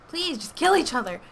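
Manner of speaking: high, loud exasperated plea; desperate cry. Real, not acted. Gamer begging the two players to fight, loud exasperated cry. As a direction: cry out for them to finish.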